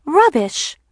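high, loud, prim dismissal; emphatic agreement. A narrator's reading. Mercedes agrees with her fed-up sister, emphatically scornful. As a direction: back up the scorn with gusto. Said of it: "Mercedes agrees".

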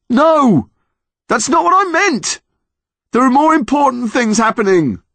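high furious shouting; angry yell. Acted. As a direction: yell it in protest. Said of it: "angry yell".

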